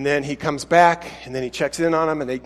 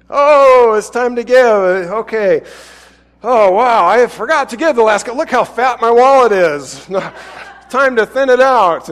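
much higher and louder, bragging and gleeful; mock show-off giver; 6 semitones higher, 7 dB louder than usual. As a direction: trumpet your giving like a braggart, laughing. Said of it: "announce it with trumpets".

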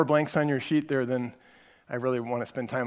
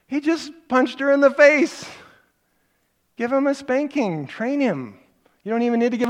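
exasperated; 10 semitones higher, 7 dB louder than usual. Real, not acted. Parent teacher ranting at the passive dad, exasperated. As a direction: raise it up in disbelief.

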